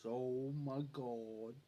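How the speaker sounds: very slow, low, softened drawl; slow talker imitation. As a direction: stretch it out in imitated amazement. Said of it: "That's how he talked".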